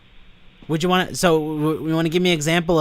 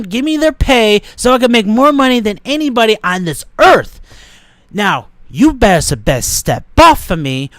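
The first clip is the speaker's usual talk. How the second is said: loud, higher, boastful wrestling-ring trash talk; wrestler promo impression; 5 semitones higher, 5 dB louder than usual. Warning the manager to step off, loud wrestler boast. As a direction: hype yourself up like a champ cutting a promo. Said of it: "did you like my impression".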